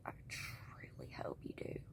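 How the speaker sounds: very soft, breath-only aside; hushed whisper. Real, not acted. Sincere-sounding aside to the mocked mom.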